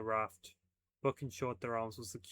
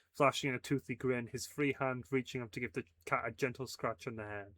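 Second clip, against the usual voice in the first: ordinary delivery, nothing marked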